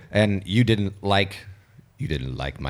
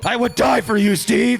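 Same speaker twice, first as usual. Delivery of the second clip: high-pitched hammed-up devotion; mock adoring fan; 10 semitones higher, 3 dB louder than usual. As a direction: cry out like a smitten superfan. Mock fan cheering at the panel.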